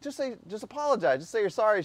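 pleading dad. Retold or imitated. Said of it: "I'm like".

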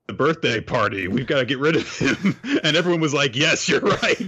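high, loud, laughing through quotes; laughing retelling. Retold or imitated. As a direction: giggle out the game chat. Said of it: "it was like".

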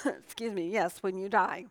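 coughing, throat choked; choking. Real, not acted. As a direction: speak through a choked throat. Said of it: "throat got choked".

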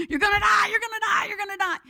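high, loud panicked repeat, fading at the end; inner panic screaming. Retold or imitated. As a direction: let the fear inside shout it out. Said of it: "inside of me is screaming".

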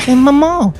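put-on high, coy Southern belle accent. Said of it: "is she a Southern belle?".

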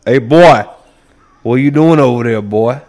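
mock Alabama accent called out across a yard; Alabama movie accent. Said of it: "every movie I ever watch, you go to Alabama".

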